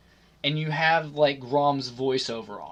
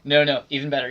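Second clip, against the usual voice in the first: ordinary talk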